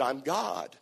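low, soft voice from below; lightly voiced divine answer, slow and quiet; God. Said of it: "The, the voice from below said".

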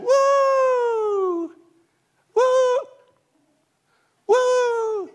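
long, very high, loud whoops; joyful whooping. Acted. Preacher voicing Israelites coming out of bondage, joyful whooping. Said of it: "and they're saying".

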